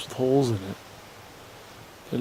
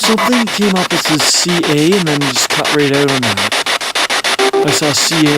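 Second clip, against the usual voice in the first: excited; 7 dB louder than usual. Real, not acted. Excited at device reading.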